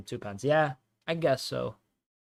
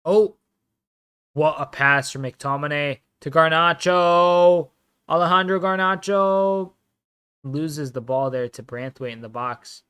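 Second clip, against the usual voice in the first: excited commentator call; play-by-play; 2 semitones higher, 8 dB louder than usual. Acted. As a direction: call the action as it happens.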